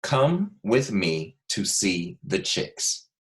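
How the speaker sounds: very slow, every word spaced out; dictation pace. Shown as a demonstration. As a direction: dictate slowly so children can write. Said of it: "you are going to write".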